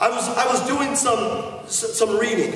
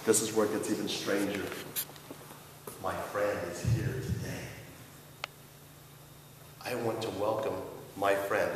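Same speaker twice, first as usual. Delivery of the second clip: hushed suspense; 13 semitones lower, 13 dB quieter than usual. Real, not acted. Hushed build-up to reveal.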